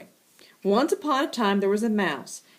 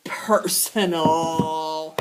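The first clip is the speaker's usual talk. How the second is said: lower, very slow threat; cat vowing revenge, lower and slow; menacing vow; 5 semitones lower, much slower than usual. Acted. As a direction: spell out the threat slowly. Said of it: "Chester says".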